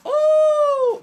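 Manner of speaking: very high, loud shocked oh; startled squeal. Real, not acted. Streamer yelping at the thought of a long flight. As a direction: yelp at the idea.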